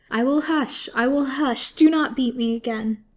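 high, loud frightened wailing; submissive wail. A narrator's reading. Prisoner begging Lily not to beat her, wailing. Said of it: "wailed out submissively".

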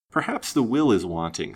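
ordinary delivery, nothing marked

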